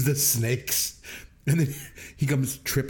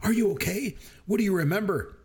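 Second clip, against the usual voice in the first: lightly voiced onlookers, higher pitch; concerned onlookers; 7 semitones higher than usual. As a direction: retell their anxious questions in a higher voice. Voicing worried onlookers from film.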